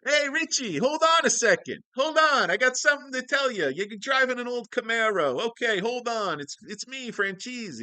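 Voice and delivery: high, loud frantic impression; pleading caller. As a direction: yell it high, begging him to wait. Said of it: "He's like".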